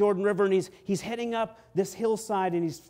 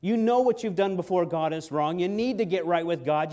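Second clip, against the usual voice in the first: ordinary delivery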